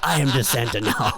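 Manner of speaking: laughing. Acted. Repeats Santa bit, laughing.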